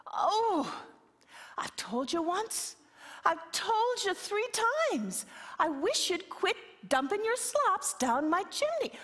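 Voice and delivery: high, thin little-creature voice; small high fairy-folk plea; wee voice. Acted. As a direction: squeak it out like a tiny fellow under the wall. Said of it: "here comes the voice again".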